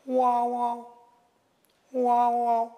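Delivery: high, drawn-out "wah, wah" bird cry; level, high-pitched cawing call; raven call. Acted. Raven calling from the tree.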